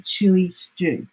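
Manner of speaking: plain reading voice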